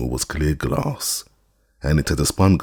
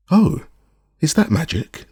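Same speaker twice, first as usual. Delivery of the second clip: high-pitched creature asking a question; high voice, jumping up on the first word; inquisitive cat voice; 12 semitones higher than usual. A narrator's reading. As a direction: pipe up high as the glass cat. Glass cat questions the magician.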